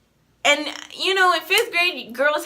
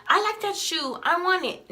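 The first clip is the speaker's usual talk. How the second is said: lightly voiced eager child, pitched up; a higher, simple kid's wanting, lightly imitated; young self; 7 semitones higher than usual. Fifth grader wanting cheap shoes, lightly voiced young self.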